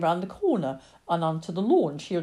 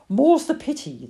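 usual speaking voice

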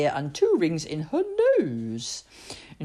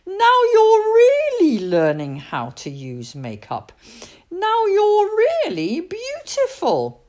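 proud adults; 13 semitones higher, 5 dB louder, slower than usual. Acted. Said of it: "and they all said".

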